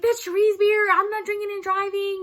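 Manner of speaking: high, loud "That's Cherie's beer"; a friend swearing she isn't drinking and driving; insistent defense. Retold or imitated.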